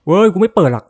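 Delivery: loud, high panicked refusal; scared shout. Real, not acted. Player refuses the door, shouting in fright. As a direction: yell it like you won't go near that door.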